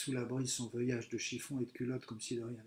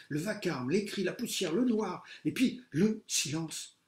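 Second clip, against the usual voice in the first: climbing higher and louder with each word; rising alarm; 6 semitones higher, 5 dB louder than usual. A narrator's reading. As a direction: build the panic word by word. Narrating the collapse.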